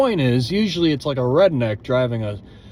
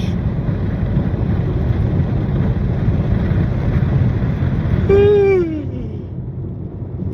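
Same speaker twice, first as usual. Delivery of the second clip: huge sigh; 12 semitones higher than usual. Real, not acted. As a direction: let out a huge exhausted sigh. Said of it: "stole the oxygen in this car".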